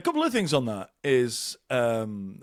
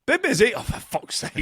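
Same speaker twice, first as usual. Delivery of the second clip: blurted cabbie question; 11 semitones higher than usual. Retold or imitated. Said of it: "and you go".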